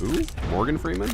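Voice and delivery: can't believe what you heard; incredulous question. Real, not acted. Friend baffled by the actor's name, high incredulous squeak.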